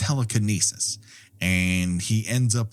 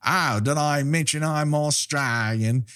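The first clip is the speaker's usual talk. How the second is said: put-on Australian accent, pitched up; [name]; 5 semitones higher than usual. Quoting a movie-riffing joke, mock Australian accent. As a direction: do a jokey, higher Australian accent. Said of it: "I can kind of do an Australian accent".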